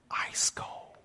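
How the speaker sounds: hushed ice cold; whisper. Real, not acted. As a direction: breathe it like a secret. Punchline about daughter.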